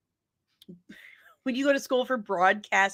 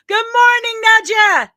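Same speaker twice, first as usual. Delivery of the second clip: high loud call across the room; bright shouted hello; shouted greeting; 11 semitones higher, 11 dB louder than usual. Real, not acted. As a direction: call out a cheery good morning. Greeting someone loudly.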